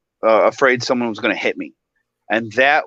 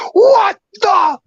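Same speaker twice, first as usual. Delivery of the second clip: put-on high, loud drunk yell; retold furious shout; belligerent yelling; 4 dB louder than usual. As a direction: imitate a drunk dad screaming at the umpire. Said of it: "Just getting belligerent".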